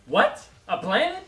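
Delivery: high, loud disbelief; shocked and outraged; incredulous. Real, not acted. Incredulous reaction.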